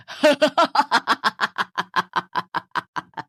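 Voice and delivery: a long eerie laugh drifting out of the dark; eerie ghostly laugh. Acted. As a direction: laugh like a spirit hidden in the fog. (भुतहा डरावनी हँसी)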